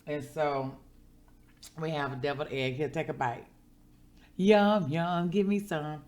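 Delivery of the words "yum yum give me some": loud, happy yum over the food; tasting her devilled egg, loud and delighted. Real, not acted. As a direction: savour the bite out loud.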